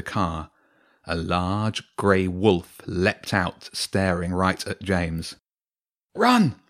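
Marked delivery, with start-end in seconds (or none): 6.1-6.6 high, louder cry to flee; urgent shout. Acted. Urgent warning in chase scene.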